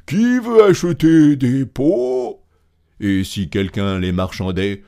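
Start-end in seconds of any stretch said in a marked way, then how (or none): 0.1-2.4 loud, slow, high singsong call; street vendor's cry. A narrator's reading. Grand Claus looking for buyers, loud drawn-out vendor's cry. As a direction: hawk it slowly and loudly for buyers. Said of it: "continua-t-il".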